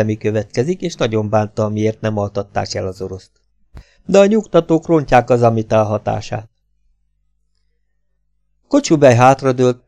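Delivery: ordinary delivery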